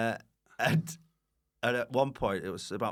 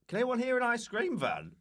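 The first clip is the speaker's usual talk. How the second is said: high mock voice. Retold studio mishap quote.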